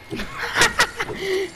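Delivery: high burst of laughter; laughing. Real, not acted. Gamer reacting to the match, high laughing burst.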